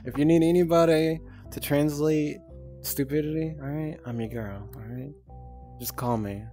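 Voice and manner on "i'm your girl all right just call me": ordinary talk, nothing marked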